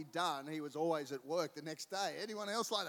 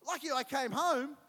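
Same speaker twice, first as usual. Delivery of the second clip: son's relief; 10 semitones higher, 9 dB louder than usual. Retold or imitated. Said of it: "and he's gone".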